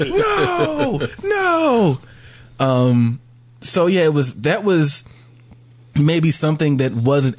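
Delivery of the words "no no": high-pitched drawn-out mock wail of refusal; mock despair. Imitating the beat-down fan host, mock despairing wail. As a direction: wail it far above your usual pitch, hammed-up. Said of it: "more and more beat down".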